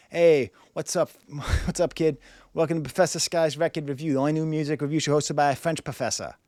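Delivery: working-class Boston guy voice; hammed-up Boston townie accent. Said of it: "try to put on that accent".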